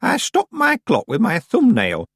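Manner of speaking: put-on high-pitched, boastful old woman; proud old witch. A narrator's reading. Miss Treason boasting to Tiffany, proud. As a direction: show off the trick with pride. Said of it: "said Miss Treason proudly".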